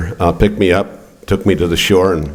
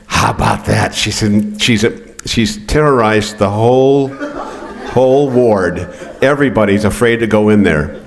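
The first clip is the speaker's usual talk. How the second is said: lightly voiced nurse, a touch higher, laughing; amused nurse; 4 semitones higher than usual. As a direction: tell it like a nurse half amused, half worn out. Charge nurse telling about mom.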